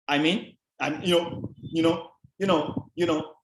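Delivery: "you know" repeated as an empty filler; verbal padding. Shown as a demonstration. Lecturer mimicking padded speech for students, repeated fillers. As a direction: imitate a habit of padding between thoughts. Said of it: "that is padding".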